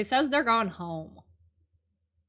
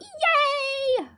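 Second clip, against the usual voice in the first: excited cheer. Acted.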